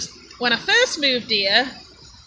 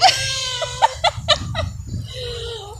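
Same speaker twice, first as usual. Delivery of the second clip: high, long wheezing laugh; high squealing giggle fit; helpless laugh; 12 semitones higher, 4 dB quieter than usual. Real, not acted. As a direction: crack up completely. Cracks up at banter.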